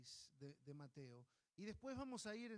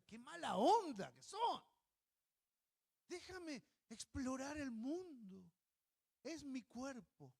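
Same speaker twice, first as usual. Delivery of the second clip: slow, higher mock-indignant baby voice; baby's thoughts; 7 semitones higher, 8 dB louder, slower than usual. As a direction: sulk like a toddler wanting freedom. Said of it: "si el bebé tuviera más palabras, pensaría". (bebé indignado, lento y agudo)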